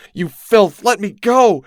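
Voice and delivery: high, loud furious shriek; building frantic shriek; crying out. A narrator's reading. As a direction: scream defiance at her captors. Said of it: "the woman cried out".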